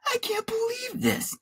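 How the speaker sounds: very high, strained yell held back in volume. A narrator's reading. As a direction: squeal it out in outrage. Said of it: "I yelled".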